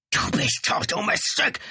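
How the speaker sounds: harsh, high hiss of rage; hissed threat. A narrator's reading. Brother threatens the woman while held back, hissing. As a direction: hiss it venomously. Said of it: "fauchte der Mann".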